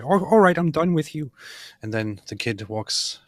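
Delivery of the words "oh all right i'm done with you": Peterson impression. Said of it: "Jordan Peterson goes like".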